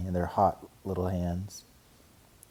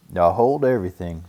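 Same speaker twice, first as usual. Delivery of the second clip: firm stop; 9 dB louder than usual. A narrator's reading. Papa stopping excited cubs.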